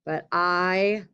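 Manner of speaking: slow, drawn-out dictation. Shown as a demonstration. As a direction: spell it out as you write. Teacher dictates letter aloud.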